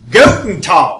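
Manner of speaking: put-on loud, heavily accented German greeting. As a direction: bark it out in thick German. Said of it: "it is heavily accented".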